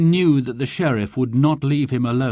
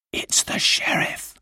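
hushed, urgent stage whisper; secretive whispered warning; 4 dB quieter than usual. A narrator's reading. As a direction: whisper it so nobody below hears. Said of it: "whispered Robin".

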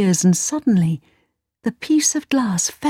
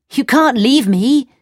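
put-on higher-pitched boy's plea; pleading protest; 6 semitones higher, 4 dB louder than usual. A narrator's reading. Kay begs the Snow Queen not to leave, pleading. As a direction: protest at being left behind. Boy protests at being left.

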